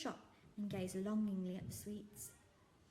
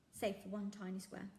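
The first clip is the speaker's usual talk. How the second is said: ordinary delivery, nothing marked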